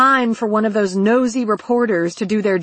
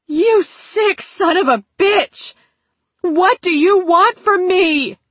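much higher, desperate outburst; screamed; 9 semitones higher, 5 dB louder, slower than usual. A narrator's reading. Captive demanding what her captor wants, screaming. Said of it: "screamed the words as loud".

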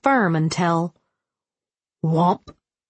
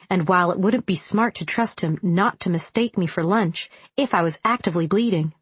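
ordinary delivery, nothing marked

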